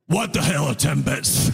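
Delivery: low, baffled question; confused low retort. Retold or imitated. Immigrant to customer, low baffled retort. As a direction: drop the voice, totally lost. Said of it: "To which I replied".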